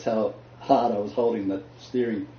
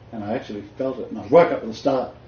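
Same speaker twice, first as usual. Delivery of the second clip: ordinary talk, nothing marked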